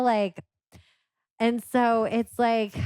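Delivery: usual speaking voice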